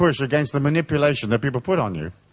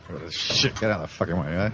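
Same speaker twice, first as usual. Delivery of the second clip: muttered aside; 10 semitones lower, 8 dB quieter than usual. Real, not acted. Aside about the crowded hall.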